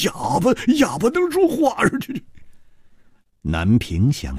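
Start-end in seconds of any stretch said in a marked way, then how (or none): 0.0-2.2 very high, startled question; incredulous high pitch trailing into stutters; stammering disbelief. Acted. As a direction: squeak it out, flabbergasted. Astonished that a mute talks.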